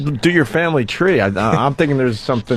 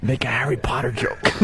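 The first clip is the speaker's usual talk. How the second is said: whisper; 6 dB quieter than usual. Real, not acted. Aside prompting cohost.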